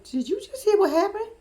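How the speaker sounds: high, incredulous question; astonished sister. Retold or imitated. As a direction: ask it high in mock disbelief. Sister leans in to ask mama.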